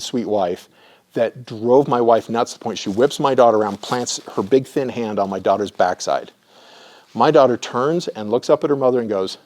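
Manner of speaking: normal talking voice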